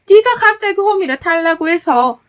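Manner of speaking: loud, high-pitched annoyed complaint; irritated blaming. Acted. Mother-in-law blaming daughter-in-law for asking for the hoe, irritated. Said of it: "라는 짜증을 부리면서".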